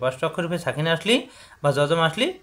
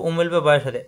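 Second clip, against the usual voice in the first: ordinary talk, nothing marked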